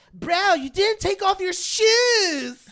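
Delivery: high, loud, slow matter-of-fact; mocking friend. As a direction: spell out the rule like it's obvious. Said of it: "They're like".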